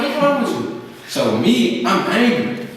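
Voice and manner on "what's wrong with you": much higher, insistent mother's voice; pushy mother. Retold or imitated. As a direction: press him like a worried mom. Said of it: "So she's like".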